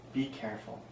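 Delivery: hushed warning. A narrator's reading. Boy warning daddy about the monster, hushed. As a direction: warn daddy in a hush. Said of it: "whispered Dagan".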